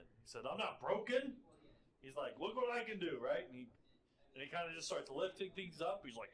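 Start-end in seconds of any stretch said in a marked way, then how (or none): 0.5-1.4 higher, louder protest; raised, indignant denial; defiant insistence. Acted. Dekul insists he is fine. 2.4-3.2 higher, louder boast; excited, pitched-up look at this; showing off. Acted. Dekul shows off powers.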